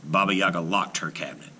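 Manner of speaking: usual speaking voice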